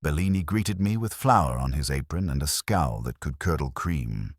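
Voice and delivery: ordinary delivery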